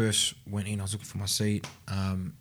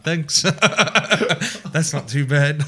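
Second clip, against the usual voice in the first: giggly, higher and louder, laughing through it; laughing, smiling; 7 semitones higher, 6 dB louder, slower than usual. Retold or imitated. Grinning at the judge, laughing and higher. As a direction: laugh it off like it's nothing.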